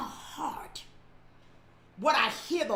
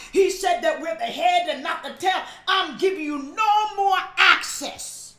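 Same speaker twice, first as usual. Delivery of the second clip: higher, louder shouted preaching; full-force preaching; 3 semitones higher, 5 dB louder than usual. Real, not acted. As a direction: preach it loud and high.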